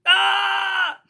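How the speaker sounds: high, loud exclamation; excited cry. Real, not acted. Storyteller cries out to the children, loud and high. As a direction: burst out with delight. Teller's exclamation after punchline.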